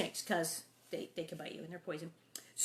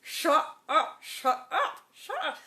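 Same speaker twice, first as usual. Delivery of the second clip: louder, higher mock hush; exasperated parents; 7 semitones higher, 7 dB louder, slower than usual. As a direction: shush the kid over and over. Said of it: "the parents are like".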